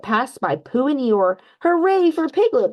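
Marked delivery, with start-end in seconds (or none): 1.6-2.7 high, loud hooray; cheering. A narrator's reading. Pooh cheers as Piglet sails past, excited. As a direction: cheer your friend on. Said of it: "Pooh cheered".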